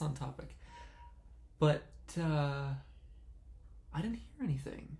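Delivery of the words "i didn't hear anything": breathy spooky whisper. Acted. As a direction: whisper it into the silence. Spooky whisper after silence.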